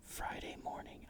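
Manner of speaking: plain reading voice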